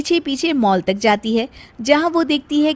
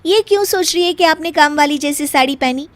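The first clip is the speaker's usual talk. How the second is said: higher, louder playful bahu voice; cheeky daughter-in-law; 5 semitones higher, 3 dB louder than usual. Acted. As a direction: tease the mother-in-law, upbeat and sure. Narrator voices Meena to mother-in-law. (सास को छेड़ती बहू, ऊँची चहकती आवाज़)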